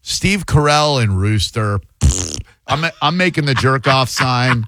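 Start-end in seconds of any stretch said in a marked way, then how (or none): 2.0-2.4 low lip-blown pfft; short scornful lip noise; dismissive raspberry. Real, not acted. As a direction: blow it off with a raspberry. Dismisses a nominated show.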